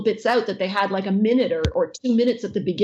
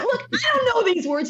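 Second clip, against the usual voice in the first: much higher squeaky character voice; high cartoon voice; 13 semitones higher than usual. Actress protesting to the fan in her character voice, pleading. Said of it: "I'm like".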